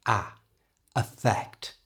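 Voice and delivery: "Affect" with its A sounded strongly; strong initial A. Shown as a demonstration. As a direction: give the first A of "Affect" its full open sound. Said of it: "that A is pronounced quite strongly".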